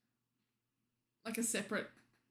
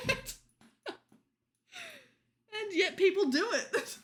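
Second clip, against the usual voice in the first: giggling through the words, higher and louder; laughing; 10 semitones higher, 5 dB louder than usual. Real, not acted. Laughing to chat about players who trash talk anyway. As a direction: crack up at the absurdity.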